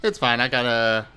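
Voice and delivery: usual speaking voice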